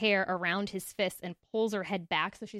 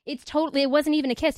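defensive protest; 6 semitones higher, 8 dB louder than usual. Retold or imitated. Girl pleading in retold scene.

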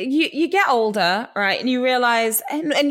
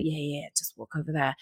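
hushed reply; 7 semitones lower, 11 dB quieter than usual. Retold or imitated. Friend answering the whisper.

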